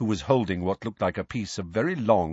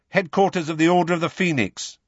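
fast instant reply; 9 semitones higher, 4 dB louder, faster than usual. A narrator's reading. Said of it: "said Ron at once".

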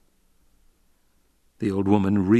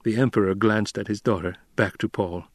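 ordinary delivery

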